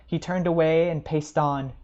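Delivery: ordinary delivery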